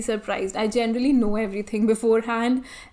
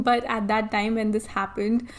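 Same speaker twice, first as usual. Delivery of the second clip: ordinary delivery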